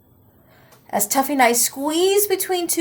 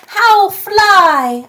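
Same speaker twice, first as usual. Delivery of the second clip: loud, much higher troll demand; troll roar; 9 semitones higher, 8 dB louder than usual. Acted. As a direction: roar the question like a greedy troll. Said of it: "the trolls roared".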